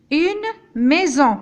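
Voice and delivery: maison slowly, S voiced as Z. Shown as a demonstration. Teacher modelling maison slowly, S as Z. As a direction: stretch maison, buzzing the S. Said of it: "Nous avons la voyelle I".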